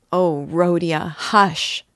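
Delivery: loud, pained cry, rising on "hush"; bitter cry. A narrator's reading. Sister silencing her brother's grim question, bitter cry. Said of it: "cried Dunya bitterly".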